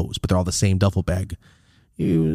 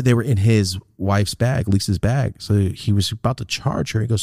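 normal talking voice

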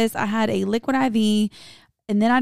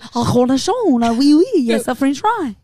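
mock French accent; 3 semitones higher, 7 dB louder than usual. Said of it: "I'm like".

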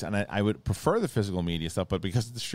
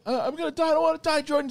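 very high, loud hammed-up terror; panicked whine; 5 dB louder, faster than usual. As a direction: wail it like he's sure he'll drown. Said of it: "He's like".

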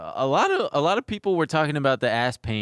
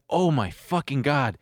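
sudden realization; 4 dB louder, slower than usual. Retold or imitated. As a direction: realize it all at once. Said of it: "I was like".

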